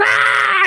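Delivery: short, high imitated bawl; mock wail. Wife told to go home, high mock wail. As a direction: burst out in a high mock cry.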